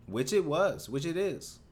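smug insistence. Real, not acted. Smug insistence he was right.

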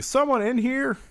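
high-pitched questioning call; mock calling out. Husband searching the barn for an intruder, mock calling out. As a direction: call out like a polite man investigating.

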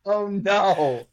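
high laughing oh no; tipsy mock dismay; laughing despair. Real, not acted. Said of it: "You set me up for failure".

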